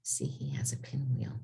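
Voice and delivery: hushed whisper; whispered aside. Real, not acted. Teacher whispering an aside to the kids about the picture. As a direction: lean in and whisper.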